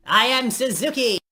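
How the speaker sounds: high, loud hammy gloating; evil alarm clock villain. Acted. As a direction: gloat like a cartoon supervillain over his captive. Villain reveals himself, loud.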